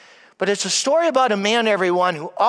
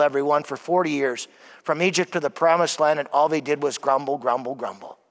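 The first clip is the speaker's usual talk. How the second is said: normal talking voice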